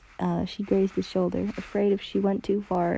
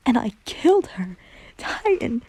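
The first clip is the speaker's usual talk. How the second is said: screech; 4 semitones higher, 4 dB louder than usual. Acted. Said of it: "he screeched".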